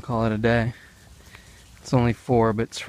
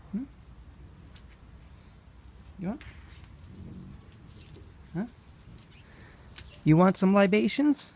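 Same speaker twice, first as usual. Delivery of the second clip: pet talk; 7 semitones higher, 4 dB quieter than usual. Real, not acted. Offering beer to goats.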